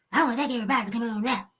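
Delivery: high, very fast mumbled imitation; Eddie Long. Host mocks the preacher's strange voice, high rushed mumble. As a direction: run the words together in a small put-on voice. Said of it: "Why you sound".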